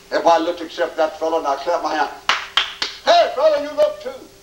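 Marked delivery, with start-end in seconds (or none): 3.0-4.3 high-pitched call across the room; acted sharp summons, pitched way up. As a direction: hail a man who isn't looking. Calling out to inattentive man.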